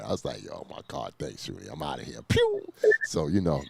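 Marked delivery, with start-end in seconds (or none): 0.5-2.2 hushed breathy aside under the breath; whispering. Retold or imitated. Retold quick exit aside. 2.3-2.6 squeaky pew zoom noise; high zip sound effect. Retold or imitated.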